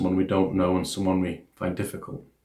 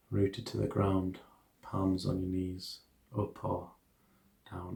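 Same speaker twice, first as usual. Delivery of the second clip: soft, hushed meditation guidance; guided meditation; 7 dB quieter than usual. Acted. Teacher guiding listeners through an equanimity meditation, soft hushed guidance.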